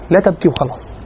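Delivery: normal talking voice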